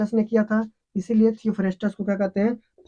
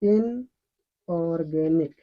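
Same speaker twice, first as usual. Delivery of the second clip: Inorganic stretched out very slowly, syllable by syllable; slow drilled word; 3 semitones lower, much slower than usual. Shown as a demonstration. Teacher drilling students on the term, very slow. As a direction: spell out inorganic slowly for the class to copy. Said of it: "क्या बोला जाता है?".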